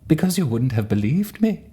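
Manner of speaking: hammed-up sugary good witch; good witch reply. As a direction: chirp it back like a fairy-tale witch. Witch answers Dorothy in Oz.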